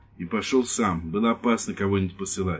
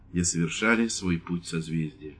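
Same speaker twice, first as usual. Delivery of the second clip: usual speaking voice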